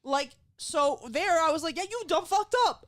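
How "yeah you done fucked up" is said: high, gleeful mock yell; pitched-up accusing outburst; retold yell at mom. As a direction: let loose with comic blame. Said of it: "And I could yell at you now".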